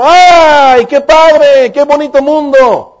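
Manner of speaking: high, loud, overjoyed cry of praise; jubilant angels shouting. Acted. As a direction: shout for joy like angels seeing the new world. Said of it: "los ángeles la alzaron, la, gritaron".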